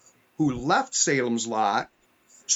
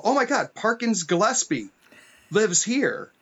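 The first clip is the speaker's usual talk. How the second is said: imitating an excited outburst, higher pitch; retold excited yell; 7 semitones higher than usual. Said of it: "I yell out".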